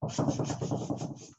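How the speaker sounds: unvoiced tss; soft monkey tsk. A narrator's reading. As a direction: mimic monkeys. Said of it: "and said".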